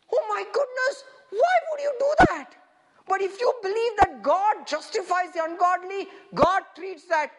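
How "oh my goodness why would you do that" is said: high, loud mock dismay; shocked protest. Preacher voices shock at God justifying wrongdoers, high-pitched disbelief. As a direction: wail it in disbelief.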